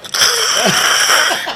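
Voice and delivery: breathy high burst of laughter. Real, not acted. Storyteller to friends about the angry goose, a high unvoiced laugh.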